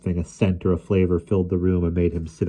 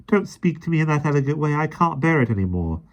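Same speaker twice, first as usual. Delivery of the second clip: put-on pleading boy; 8 semitones higher than usual. A narrator's reading. Michael begging Mary.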